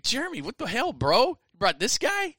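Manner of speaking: high-pitched, baffled complaint; incredulous teammate. Retold or imitated. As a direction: imitate a teammate questioning his buddy in disbelief. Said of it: "He's like".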